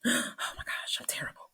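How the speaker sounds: imitated gasp, then a hushed whisper; horrified whisper. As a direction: whisper it like a mortified client behind a hand. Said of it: "Covered her mouth and everything".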